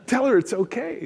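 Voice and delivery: lightly voiced high coaxing tempter; a devil on the shoulder egging him on; tempting shoulder voice. The other shoulder voice tempts the boss, a high coax. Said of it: "two voices sitting on each of my shoulders".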